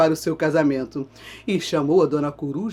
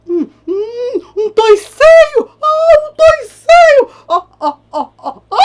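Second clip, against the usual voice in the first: high, loud squealing panic; hammed-up terrified cry; mock panic; 10 dB louder, slower than usual. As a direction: shriek as he tumbles into the pot. Ratão falls into the pot.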